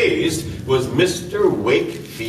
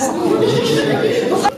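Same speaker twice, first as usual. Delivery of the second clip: hearty laugh. Acted. Amused reply to the professor joke.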